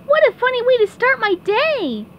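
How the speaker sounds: ordinary talk, nothing marked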